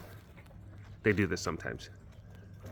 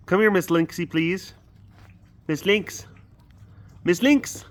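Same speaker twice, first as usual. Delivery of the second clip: calling a cat; 8 semitones higher, 4 dB louder than usual. Real, not acted.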